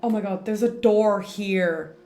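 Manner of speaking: normal talking voice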